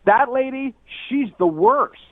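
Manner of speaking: loud retold insult. Imitating his date's loud put-down of Ina Garten. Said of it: "in a really loud voice".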